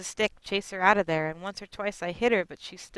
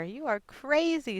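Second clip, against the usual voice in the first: daughter scolding; 7 semitones higher, slower than usual. Retold or imitated. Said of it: "say my daughter".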